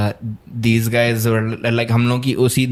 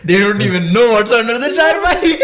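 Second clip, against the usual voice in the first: laughing; 7 semitones higher than usual. Real, not acted. Giggling at his friend's secret.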